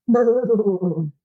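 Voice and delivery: shivering brr. Retold or imitated. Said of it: "he says".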